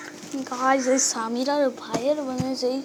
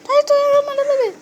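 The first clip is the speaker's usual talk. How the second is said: put-on much higher and louder girl's voice, indignant; high girlish scolding; 12 semitones higher, 6 dB louder than usual. Sister scolds her brother for hitting, high indignant girl voice. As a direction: scold him like a cross little sister.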